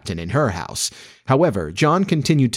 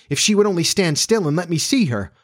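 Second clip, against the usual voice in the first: higher-pitched, wishful cry; eager cry; 8 semitones higher than usual. A narrator's reading. Wendy longs to see the fairy, crying out. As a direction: cry it out in a lifted voice. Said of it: "she cried".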